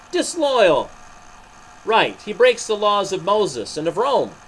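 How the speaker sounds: crowd shouting. Acted. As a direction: shout it as the mob. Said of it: "the people shouted".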